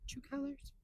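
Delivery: whisper. Real, not acted. Whispered aside.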